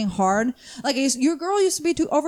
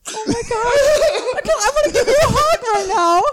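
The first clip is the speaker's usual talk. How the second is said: laughing, overwhelmed; 10 semitones higher, 5 dB louder than usual. Real, not acted. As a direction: laugh through it while offering affection. Moved friend laughing, offering a hug.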